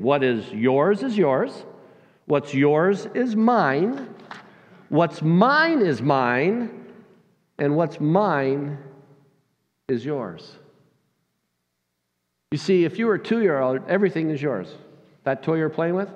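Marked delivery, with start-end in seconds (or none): 0.0-10.6 very slow, each phrase set apart; slow reading of a list. Shown as a demonstration. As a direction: spell out each kind slowly. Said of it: "Look at it on your screen".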